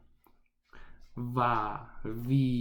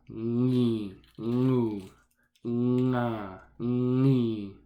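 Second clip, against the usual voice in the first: syllable drill; slower than usual. Shown as a demonstration. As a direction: read each syllable carefully, like a teacher. Reciting syllable chart slowly.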